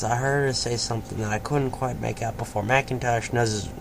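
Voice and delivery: ordinary delivery, nothing marked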